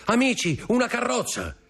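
very high, urgent calling; acted high-pitched shouts; excited lookout's cry. A narrator's reading. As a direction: announce the arrival to everyone below. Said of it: "gridò uno dei convitati".

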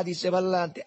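usual speaking voice

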